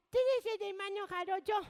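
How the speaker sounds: lightly voiced little girl, asking. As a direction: play a small girl asking shyly.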